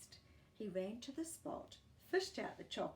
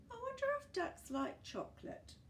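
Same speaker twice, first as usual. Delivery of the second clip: much higher, curious little voice; musing. A narrator's reading. Gumboot thinking to himself about feeding ducks, higher curious voice. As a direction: wonder aloud like a small creature. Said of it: "thought Gumboot".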